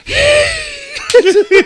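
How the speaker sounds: high, loud cackle of laughter; shrieking laugh. Real, not acted. Storyteller losing it at his own punchline, high loud laugh. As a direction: burst out in a squealing laugh.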